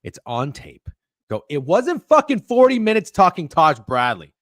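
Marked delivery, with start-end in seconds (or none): 1.5-4.3 loud, indignant denial; loud protest. Real, not acted. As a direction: push back hard and loud. Defending himself, loud.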